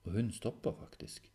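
usual speaking voice

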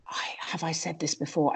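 a confused whisper to yourself mid-show; hushed, puzzled aside; whispered self-doubt. Retold or imitated. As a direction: whisper it as if lost onstage. Comic onstage losing track, self-quote.

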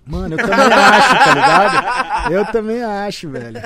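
loud, high, real laughing through the words; laughing agreement. Real, not acted. Guest agreeing with host's joke about Ronaldo, laughing loudly. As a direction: agree loudly while cracking up.

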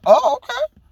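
high, falsetto mock reply of a rebuffed man; mock hurt husband. Imitating husband backing off after rejection, high mock voice. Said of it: "He's like".

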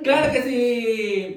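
loud, slow, emphatic claro que sí; drawn-out cheer. Acted. Host cheers that the show runs on time, drawn-out loud.

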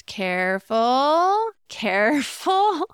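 slow, drawn-out cautionary careful; mock warning with a laugh on the second. Shown as a demonstration. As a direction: say it like a gentle warning, stretched out. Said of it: "you just have to watch the tone here".

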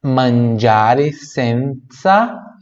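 "senza" with a ts sound, drawn out; very slow "senza". Shown as a demonstration. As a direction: stretch "senza" as sentsa. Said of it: "esse Z tem som de T S".

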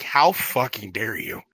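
low, slow mock indignation; mock outrage. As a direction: act deeply offended, dragging it. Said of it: "I'm like".

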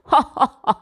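loud booming mock laugh; lion laughing. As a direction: laugh at the little mouse. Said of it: "looked at the tiny mouse and laughed".

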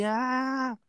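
high, drawn-out imitated wail; mock crying. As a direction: bawl like a little kid, put on. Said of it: "Aí eu chorei". (choro de criança imitado)

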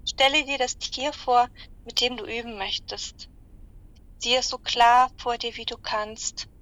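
slow, calm meditation voice; guided meditation. Acted. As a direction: guide them gently into relaxation. Guided animal visualization.

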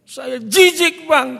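loud, high, drawn-out revulsion; acted loud disgust; disgusted outburst. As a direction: imitate someone recoiling in disgust. Wife's disgusted reply, retold.

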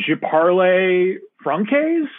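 put-on slow, high, clumsy American accent in French; mock a nervous student with a thick American accent in French; a high school kid in French class stumbling along in an American accent. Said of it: "they're like".

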